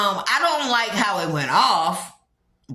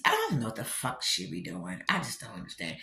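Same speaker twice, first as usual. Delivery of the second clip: much lower and much softer, under the breath; baffled mutter; 13 semitones lower, 12 dB quieter than usual. Real, not acted. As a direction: mutter it to yourself in disbelief. Baffled aside about the dress.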